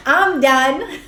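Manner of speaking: "I'm done." through a real laugh. Real, not acted. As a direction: giggle the words out.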